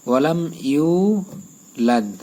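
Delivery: ইউলাদ with a long stretched a, slowly; slow, drawn ইউলাদ; drawn yulad. Shown as a demonstration. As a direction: stretch ইউলাদ one alif. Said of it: "এখানে এক আলিফ টান হবে".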